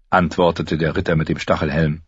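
ordinary delivery

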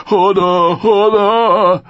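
loud, high repeated cries; calling out. A narrator's reading. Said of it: "rief Hodor wieder". (lautes, immer höheres Rufen)

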